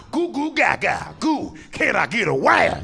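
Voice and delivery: put-on high, slow baby-talk holler; two-week-old baby. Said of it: "At two weeks old".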